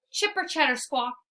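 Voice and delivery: plain reading voice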